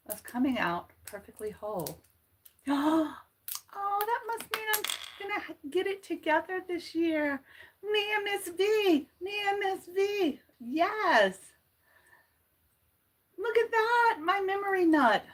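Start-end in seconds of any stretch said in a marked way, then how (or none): 2.7-3.2 sharp high gasp of delight; surprised gasp. Real, not acted. Gasping at her whole nut on the live stream. 3.8-15.2 much higher, squealing with delight; thrilled by a lucky sign; excited. Real, not acted. Delighted squeal to live viewers over the whole nut.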